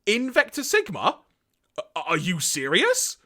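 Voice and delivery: high, loud disbelieving gasp of a question; shocked disbelief. Acted. Galvatron learning of the bomb, high shocked disbelief. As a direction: caught completely off guard.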